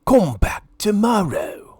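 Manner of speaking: Lord Gurr shouting. A narrator's reading. Greedy lord dismissing Minnie, mild shout. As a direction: bark it at the maid. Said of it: "he always shouted".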